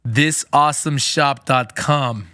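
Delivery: slow, loud dictation to a phone; voice-search command. Shown as a demonstration. Host demonstrating a voice search, slow loud command. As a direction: say it slowly and loudly, word by word. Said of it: "do a little voice search here".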